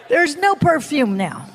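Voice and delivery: ordinary talk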